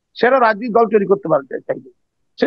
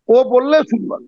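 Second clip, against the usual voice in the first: normal talking voice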